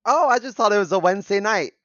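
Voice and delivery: ordinary talk, nothing marked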